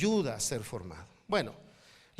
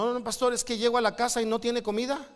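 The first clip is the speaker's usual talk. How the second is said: hammed-up whiny husband, higher and fast; mock the husband's protest, pitched up and rushed; a husband griping that there's no food, quickly and higher; complaining husband; 7 semitones higher, faster than usual.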